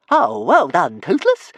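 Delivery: high, loud dragon voice; self-congratulating dragon. A narrator's reading. Toothless congratulating himself on the drop, high loud creature voice. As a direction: praise yourself loudly, pitched right up. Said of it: "Toothless congratulated himself".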